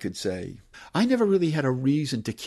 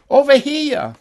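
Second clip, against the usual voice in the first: put-on high, very loud Medford-accented holler; yell; 13 semitones higher, 11 dB louder than usual. Novelist reads his townspeople calling out, yelling. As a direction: holler it across the room. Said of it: "people yelled".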